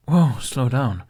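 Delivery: put-on calming an upset kid; gently slow him down; reassuring older boy.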